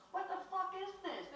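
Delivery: high, outraged outburst at the screen; retold outrage. Watching the film as a kid, shocked and outraged. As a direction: blurt it out in disbelief, pitched high. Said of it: "And I saw it and I go".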